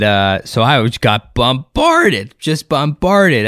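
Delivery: normal talking voice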